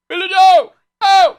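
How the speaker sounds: high shrieking shout; shouting the line. Retold or imitated. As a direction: belt it out at the top of your voice. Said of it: "I like to go".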